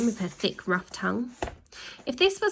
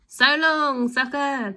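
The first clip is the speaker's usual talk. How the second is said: high, louder taunt; shouted; 6 semitones higher, 4 dB louder, slower than usual. Acted. Villains taunt the boy as they drive off, shouting. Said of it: "they shouted".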